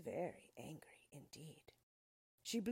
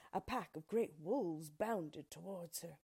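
usual speaking voice

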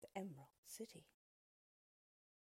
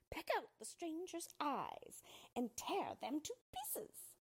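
much higher witch's command; 10 semitones higher than usual. Acted. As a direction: bark orders like a wicked witch. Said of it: "she commanded them".

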